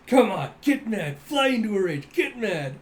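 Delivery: playful jeering chant; mock goading. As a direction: egg him on loudly. Acted kids goading an opponent.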